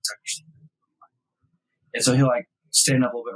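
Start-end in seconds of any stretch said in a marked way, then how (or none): 0.1-1.1 hushed urgent whisper. Retold or imitated. Dad whispering to son beside a close doe, urgent whisper. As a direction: whisper so the deer won't hear.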